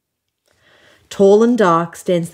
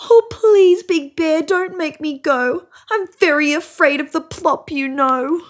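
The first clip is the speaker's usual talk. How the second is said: rabbit begging in fear; 9 semitones higher than usual. A narrator's reading. Little rabbit begs the bear.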